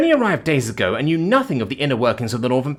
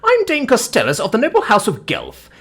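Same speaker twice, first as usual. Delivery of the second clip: high, grand self-announcement; imperious matron in full indignation; pompous haughty dame; 9 semitones higher, 3 dB louder than usual. Acted. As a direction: introduce yourself with lofty scorn.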